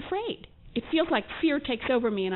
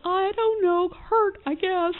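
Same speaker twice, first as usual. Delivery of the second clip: timid self; 9 semitones higher, 4 dB louder, much slower than usual. Retold or imitated. Acts out her younger self.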